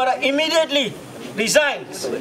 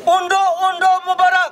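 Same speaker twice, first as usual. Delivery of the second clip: high, loud rally chant; fiery revolutionary speech; 6 semitones higher, 4 dB louder than usual. Real, not acted. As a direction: chant it out to the crowd. Leads protest chant call.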